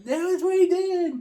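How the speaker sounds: very high, loud put-on whine; mock protesting wail. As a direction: squeal it out as a mock tattle. Acting out a protesting movie quote.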